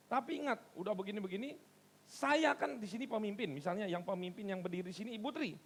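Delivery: normal talking voice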